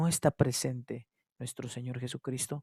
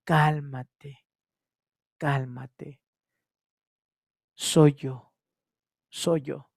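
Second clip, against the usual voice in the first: gentle reassurance; 3 dB louder, much slower than usual. Acted. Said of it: "lo que está diciendo nuestro Señor".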